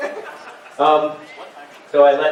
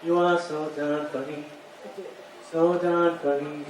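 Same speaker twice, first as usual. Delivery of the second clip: soft echo; 9 dB quieter, slower than usual. Acted. Comedian echoes his own chorus, softly. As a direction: sing it back quietly.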